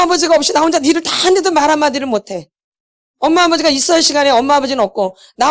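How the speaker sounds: 높아진 목소리로 복받치는 하소연; 참아온 억울함을 터뜨리듯; 서러운 하소연. Pretend. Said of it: "엄마, 아버지 막 얘기해". (부모에게 맞으며 참아온 억울함을 쏟아내는 목소리)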